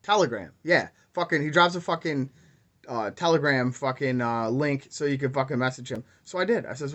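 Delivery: ordinary talk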